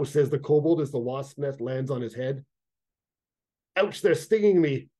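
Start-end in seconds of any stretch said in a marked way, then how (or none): 3.8-4.9 stung yelp. A narrator's reading. Wasp nest lands on kobold.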